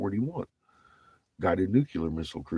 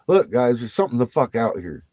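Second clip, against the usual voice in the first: louder, higher urgent warning to the crew; urgent call; 6 semitones higher, 6 dB louder than usual. Retold or imitated. Sailor insisting to the crew something is out there, urgent. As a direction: raise the alarm, half-yelling. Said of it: "And I'm like".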